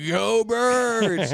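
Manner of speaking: high, loud, drawn-out fan yell; whooping mock cheer; imitated drunken cheer. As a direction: holler the team chant, stretching it out. Repeats cheer as wasted friend, yelled.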